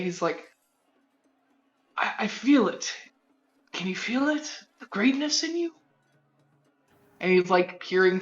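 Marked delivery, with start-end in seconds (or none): 2.0-5.7 wondering wizard. Acted. Wizard marvels at his creation.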